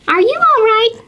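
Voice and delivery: high little squirrel voice; concerned squirrel. Acted. Squirrel asking the fallen owlet, high and concerned. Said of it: "the squirrel asks".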